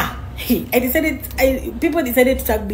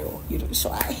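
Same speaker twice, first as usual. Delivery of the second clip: soft whisper; 5 dB quieter than usual. Real, not acted. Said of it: "teasing the ex softly".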